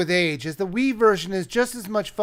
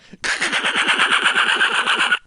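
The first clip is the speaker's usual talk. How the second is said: airy laugh. Acted. Laugh after the hunter bit.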